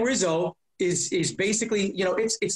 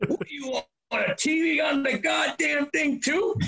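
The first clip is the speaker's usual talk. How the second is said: Bob the Cattle Rustler; 8 semitones higher than usual. Retold or imitated. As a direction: bark it at him like a loudmouth truck driver. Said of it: "big old badass Bob the Cattle Rustler".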